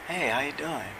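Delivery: quiet, understated reply voicing an ailing mom; soft-voiced mother. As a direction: say it softly, as the mother on the phone. Voicing his sick mother on phone.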